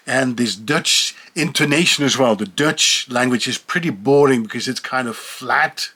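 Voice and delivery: ordinary talk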